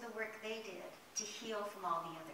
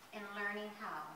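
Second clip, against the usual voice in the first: plain reading voice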